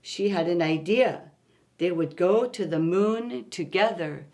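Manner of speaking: gentle read-aloud storytelling; story narration. A narrator's reading. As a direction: read it like a bedtime picture book. Reads picture book aloud.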